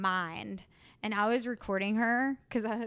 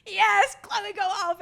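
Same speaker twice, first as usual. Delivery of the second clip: loud, raised-pitch cheer; egging on; 10 semitones higher, 9 dB louder, slower than usual. Retold or imitated. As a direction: cheer on a child's meltdown. Retold gleeful egging on of tantrum.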